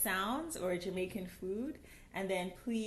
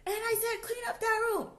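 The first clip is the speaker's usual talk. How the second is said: high, scolding mom voice; strict parent; 4 dB louder, faster than usual. Retold or imitated. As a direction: order the kid to clean up. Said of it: "you might say something like".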